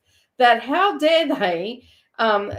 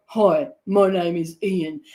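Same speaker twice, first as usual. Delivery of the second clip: slow, lower deadpan introduction; 4 semitones lower, slower than usual. Retold or imitated. Ambulance driver introduces himself to man in paddy wagon, slow deadpan. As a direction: imitate an unimpressed official, low and drawn out. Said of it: "as deadpan as ever".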